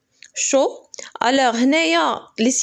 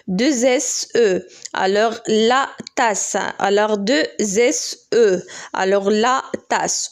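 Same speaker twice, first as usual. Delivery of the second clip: slow spelling; much slower than usual. Shown as a demonstration. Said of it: "teacher spells and reads slowly".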